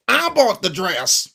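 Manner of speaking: a slow, lightly put-on first try; mock the line, not yet in character; wrong impression. Shown as a demonstration. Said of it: "Sorry, I did that wrong".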